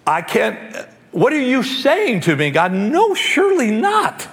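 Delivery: rising to a high, pleading protest; horrified refusal; Peter in crisis. Retold or imitated. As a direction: build from shock to high disbelief. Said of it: "you see the crisis in Peter".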